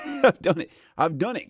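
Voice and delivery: laughing exasperation. Real, not acted. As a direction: laugh it off, a bit fed up.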